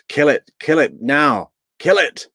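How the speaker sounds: loud, rising pleas to stop; exasperated shout. Real, not acted. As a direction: yell for them to stop it. Begging to stop the clip.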